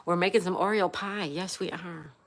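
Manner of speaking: usual speaking voice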